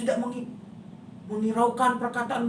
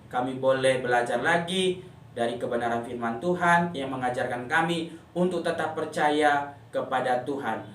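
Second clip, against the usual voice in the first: closing prayer; 6 semitones lower than usual. Acted.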